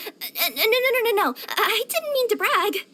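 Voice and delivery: high, rapid-fire denial, then apologetic; flustered. Acted.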